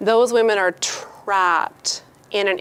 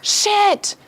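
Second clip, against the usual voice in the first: impressed applause; 10 semitones higher, 7 dB louder than usual. Retold or imitated. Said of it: "It's like".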